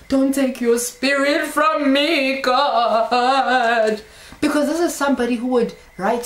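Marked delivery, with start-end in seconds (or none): 0.1-4.0 slow, drawn-out mock wail; hammed-up crying out; crying plea. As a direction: wail it slowly to God, raised pitch. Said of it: "was crying that".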